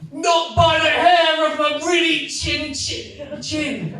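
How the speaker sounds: high, loud mock shout; hammed-up high shout of refusal; shouting back down. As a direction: yell it down from the tower. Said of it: "Rapunzel would shout back down".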